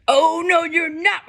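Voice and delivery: loud, high roar; troll's roar. Acted. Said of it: "roared the troll".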